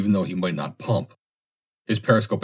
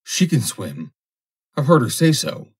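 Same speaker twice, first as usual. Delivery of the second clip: cowardly excuse; 6 semitones higher, 5 dB louder than usual. Acted.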